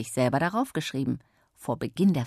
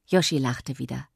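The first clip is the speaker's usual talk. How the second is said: ordinary delivery, nothing marked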